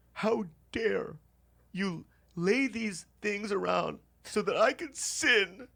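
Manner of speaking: high, slow, scandalized squeal; outraged pious roommate. Retold or imitated. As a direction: clutch your pearls like a wounded saint. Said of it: "he gets up and he goes".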